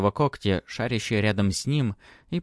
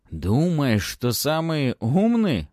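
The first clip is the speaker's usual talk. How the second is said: mocking; 6 semitones higher, 4 dB louder, much slower than usual. Baron mocks Matvey.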